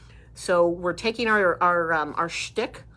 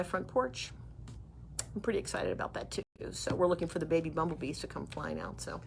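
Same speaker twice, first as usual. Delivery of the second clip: whispering; 3 semitones lower, 12 dB quieter than usual. Real, not acted. Quiet aside about bees.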